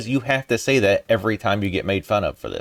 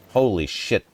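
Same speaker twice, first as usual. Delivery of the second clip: ordinary talk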